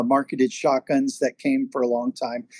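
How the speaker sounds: normal talking voice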